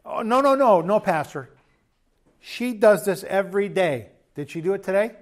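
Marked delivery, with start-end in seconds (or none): 0.0-4.1 mock protesting spouse. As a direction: play the spouse objecting to the pastor.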